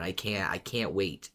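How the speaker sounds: usual speaking voice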